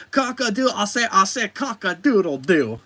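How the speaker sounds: high, hammed-up Southern rooster drawl, stretched slow on the crow; Foghorn Leghorn impression. Imagining a villain as a human Foghorn Leghorn, Southern accent. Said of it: "imagine him with a southern accent".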